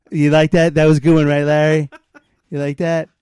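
ordinary delivery

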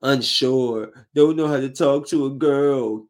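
ordinary talk, nothing marked

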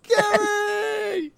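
very high crying shout; anguished cry. Acted. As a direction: wail the name in grief. Crying out the dog's name.

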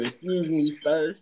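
high, polite phone voice; proper and careful, like a stranger; overly polite caller. Retold or imitated.

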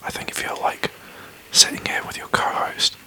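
hushed podcast whisper, breathy and close. Real, not acted. Whispers all through podcast.

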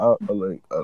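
usual speaking voice

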